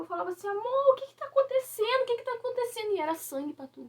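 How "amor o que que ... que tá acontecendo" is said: high, frightened pleading; begging to know what is happening; a terrified plea to her husband in the night; panicked retold cry. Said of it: "eu só gritava".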